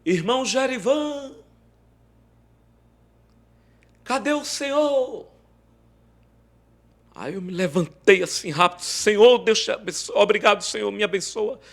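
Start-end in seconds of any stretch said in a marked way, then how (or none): 0.1-5.3 high calling voice from the street; a voice calling for Brother Jerivan. Retold or imitated. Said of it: "uma voz disse assim". 8.8-11.6 excited thanks. Retold or imitated. Rushing up thanking God.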